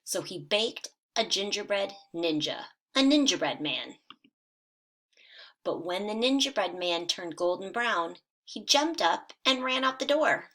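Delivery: lively storybook read-aloud for first graders; animated children's story narration. A narrator's reading. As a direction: read it like a bedtime picture book, bright and animated. Teacher reads picture book aloud.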